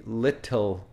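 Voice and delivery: "little" with a true T, British style. Shown as a demonstration. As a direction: say "little" without a flap, as in British English. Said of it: "in British English".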